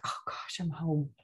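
whispered oh gosh, then soft and relieved; hushed relief. Retold or imitated. Retelling feeling at home in Ireland, hushed relieved whisper. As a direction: breathe it out like coming home at last. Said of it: "I'm like".

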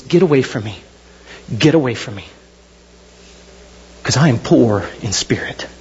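low, broken-down plea, fading to a whisper; imitated penitent, pitch dropped right down; humbled plea. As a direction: sink low in acted shame, ending hushed. Peter falls down humbled before Jesus.

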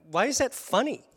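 high, loud baffled cry at friends; indignant disbelief. Retold or imitated. Soaked man asks laughing friends, high indignant disbelief. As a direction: demand why it's funny, half-outraged. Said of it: "And I'm like".

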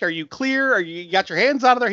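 high exasperated questioning; incredulous. Retold or imitated. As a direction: act baffled and indignant. Indignant retold questioning.

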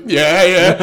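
high giggling yeah yeah; laughing reply. Retold or imitated. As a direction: answer through laughter, loud. Colleague's retold reply.

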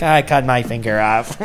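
lightly imitated child; a hint of a six-year-old; little boy. Six-year-old son telling his dad, lightly voiced little boy. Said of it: "six years old".

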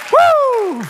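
high, sustained "woo"; exultant whoop. Real, not acted. Preacher caps his climax before the congregation, exultant whoop. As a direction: let out a piercing whoop of joy.

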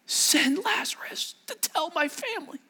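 very high, strained whispery cry; desperate plea. Acted. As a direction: beg in a thin, voiceless high cry. Rich man pleading from torment.

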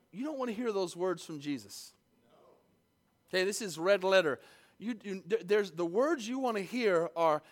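normal talking voice

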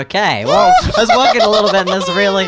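high squeaky helium voice. Real, not acted. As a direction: talk through helium. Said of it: "hyped up on helium".

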